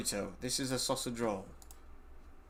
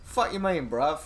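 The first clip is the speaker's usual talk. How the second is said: high, loud, confrontational London accent; Cockney accent; 5 semitones higher, 6 dB louder than usual. Shown as a demonstration. Demonstrating bruv as Londoners use it, Cockney accent. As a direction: challenge them in put-on Cockney. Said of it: "It's Cockney accent, like a London accent".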